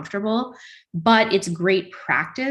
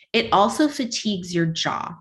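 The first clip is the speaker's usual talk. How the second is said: ordinary talk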